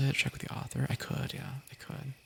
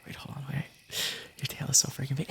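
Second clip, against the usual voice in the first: usual speaking voice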